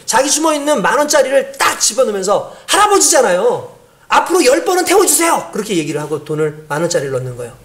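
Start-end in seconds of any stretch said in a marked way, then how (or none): 2.7-5.5 put-on higher, earnest child's voice; small child. Little boy asking the driver to let the old man ride. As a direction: plead sweetly like a little kid.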